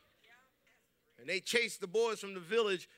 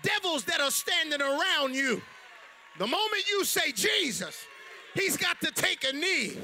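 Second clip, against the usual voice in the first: loud, high shouting sermon; full force preaching; 8 semitones higher, 6 dB louder than usual. Acted. Preacher shouting to the congregation about Jesus. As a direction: whip up the congregation.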